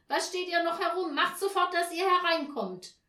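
loud, high, cross order; calling from indoors. A narrator's reading. Mother orders her children inside, loud call. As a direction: call them in sternly at the top of the voice. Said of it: "rief da Frau Janka von drinnen".

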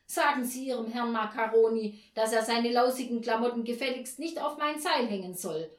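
loud, indignant telling-off; angry complaint. A narrator's reading. Frau at Zülke's door about the washing, angry complaint. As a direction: snap the demand out loudly. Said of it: "weil sie so ärgerlich war".

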